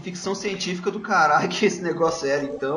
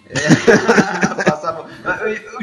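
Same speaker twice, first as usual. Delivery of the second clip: laughing; 4 semitones higher, 8 dB louder, much slower than usual. Real, not acted. Laughing at cohost's joke.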